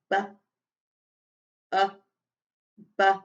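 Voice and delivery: bu, uh, bu, syllable by syllable; sounding out bubbles. Shown as a demonstration. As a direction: tap out the syllables. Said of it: "sound that word bubbles out".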